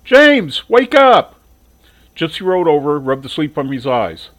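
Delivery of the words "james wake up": loud, much higher call; mother calls her son up the stairs, loud; calling upstairs. Acted. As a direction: shout up the stairs to wake him. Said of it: "Shirley called up the stairs".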